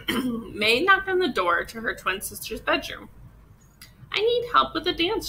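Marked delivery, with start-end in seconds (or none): 4.1-5.3 put-on little girl voice. Little girl asks for help.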